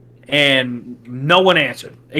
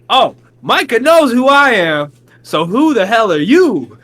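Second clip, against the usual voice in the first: raised, higher, sharp and challenging; heated retort; 5 semitones higher, 4 dB louder than usual. Retold or imitated. Telling off a stranger who claims to know Micah, heated. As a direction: snap back at a stranger. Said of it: "And I was like".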